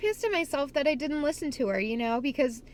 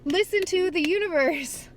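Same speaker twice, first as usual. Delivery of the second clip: wake-up call; 6 semitones higher than usual. Retold or imitated. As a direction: yell it at yourself with a cracking laugh. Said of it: "hit me like a ton of bricks".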